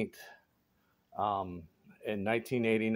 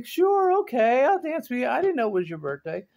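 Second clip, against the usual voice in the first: mock eager yes; 10 semitones higher, 3 dB louder than usual. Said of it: "come back and say".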